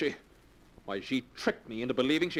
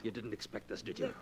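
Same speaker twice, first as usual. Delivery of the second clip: menacing hush; 6 semitones lower, 8 dB quieter than usual. Acted. Drake menaces after attack.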